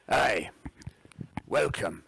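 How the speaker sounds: a lightly put-on Scottish accent. GM voices the captain welcoming players, light Scottish accent. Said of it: "in his finest, um, Scottish accent".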